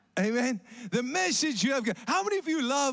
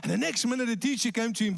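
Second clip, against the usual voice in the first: usual speaking voice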